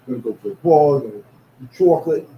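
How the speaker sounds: loud, thick New York accent demo. Shown as a demonstration. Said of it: "I have a very thick New York accent".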